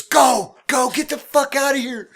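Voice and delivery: very loud, high panicked shouting; urgent shout. Retold or imitated. Woman jumping in the cab, shouting at driver to go. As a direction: yell at the driver to go now.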